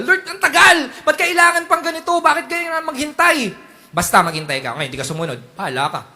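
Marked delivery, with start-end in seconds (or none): 0.0-3.5 high, laughing mock complaint; mock whining believer. Believer complaining to God about the long wait. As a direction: whine at God through a laugh.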